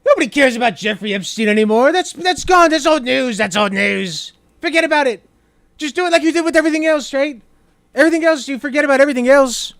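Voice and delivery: high, loud, brash Trump voice; hammed-up Trump telling everyone to forget it; loud Trump impression. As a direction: bluster like Trump waving it all off. Streamer voicing Trump, loud.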